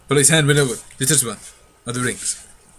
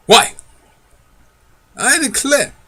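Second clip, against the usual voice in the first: much higher, surprised; delighted exclaim; 11 semitones higher, slower than usual. Acted. Polly marvels at the rings, much higher. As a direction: gasp out in wonder.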